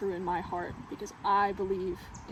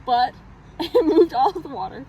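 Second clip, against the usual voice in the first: laughing; 4 semitones higher, 13 dB louder than usual. Real, not acted. Laughing at own absurd story.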